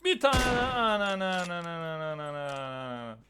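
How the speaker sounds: loud shouts fading to mutter; triumphant shouting. Real, not acted. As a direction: celebrate a kill then calm. Kill streak excitement.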